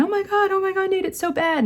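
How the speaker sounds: high, panicky child's wail, imitating her; imitating a frantic little girl, high and shrill with panic; freaking out. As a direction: mimic a kid freaking out over the blood. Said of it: "Lou was freaking out".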